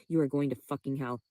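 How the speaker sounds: ordinary talk, nothing marked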